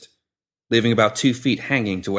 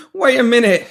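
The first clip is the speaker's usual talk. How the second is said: much higher, louder frantic begging; panicked pleading; 8 dB louder than usual. Acted. Pinky pleading with the woman holding shears, panicked. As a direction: beg for your life, backing away.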